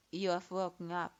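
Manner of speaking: a detached master, low and hard; stern sensei. A narrator's reading. Sensei to the waking pupil, low and cold.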